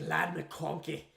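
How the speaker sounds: put-on light touch of a Scottish accent. Podcast host savouring a player's name, mild Scottish accent. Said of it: "saying that in a Scottish accent is amazing".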